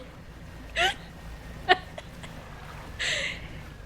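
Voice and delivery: long, high, wheezy laugh; real laughing fit. Real, not acted. Laughing at the strange woman memory, real laughing fit. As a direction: lose it laughing. Cracks up telling own story.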